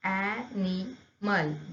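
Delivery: "animal" slowly split as a-ni-mal; "animal" broken into three drawn-out beats; syllable by syllable. Shown as a demonstration. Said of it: "जब इसका pronunciation करते हैं".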